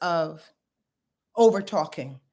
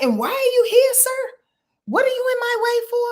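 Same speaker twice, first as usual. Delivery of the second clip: high-pitched indignant challenge; high, put-out "why you here, sir"; confrontational demand; 13 semitones higher, 4 dB louder than usual. Acted. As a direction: pitch it way up, sing-song and indignant. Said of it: "you just screaming at me like that".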